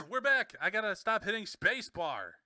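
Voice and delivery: high, loud self-scolding; flustered shout. Real, not acted. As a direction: loudly scold yourself for the slip. Flustered after repeated mistake.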